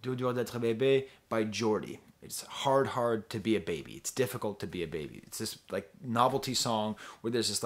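usual speaking voice

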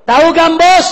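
shouted question. Real, not acted. Preacher shouting the question to the crowd. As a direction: call it out to the crowd. Louder repeat.

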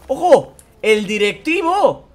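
high, loud surprised cry of recognition; startled to find the director; surprised shout. Real, not acted. Spotting the boss in his office, loud surprise.

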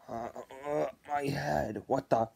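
low, faint groan; weak, dazed mumble; groggy waking. Acted. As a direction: come to slowly with a sore head. Mojo waking with hurt head.

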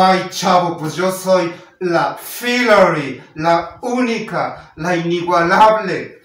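loud, slow, proud proclamation; grand self-introduction. Retold or imitated. Filary introduces herself to a puzzled boy, grandly proclaiming. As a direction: announce yourself like a star, stretching each title.

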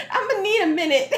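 laughing so hard it nearly cries; high, loud, breaking with laughter. Real, not acted. Overwhelmed by the movie.

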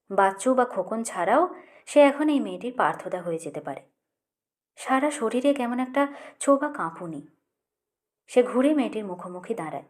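ordinary delivery

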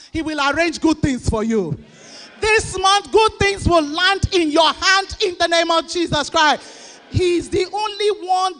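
loud, high, fast fiery preaching; a pastor shouts a blessing over his church, full-force preaching. Acted. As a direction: proclaim the blessing over the crowd like a fired-up preacher.

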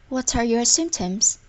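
ordinary talk, nothing marked

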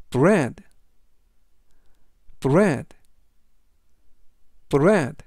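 "bread" said crisply with a falling end; clear English demo. Shown as a demonstration. Teacher models "bread" for the class to copy. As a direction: model "bread" three times for repeating. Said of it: "라고 발음하시면 되겠고요".

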